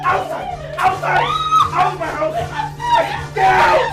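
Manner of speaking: very high, loud screams to get out; screaming rage. Acted. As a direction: throw the wife out of the house. Husband expels wife.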